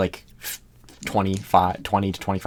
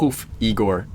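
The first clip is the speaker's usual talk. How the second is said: usual speaking voice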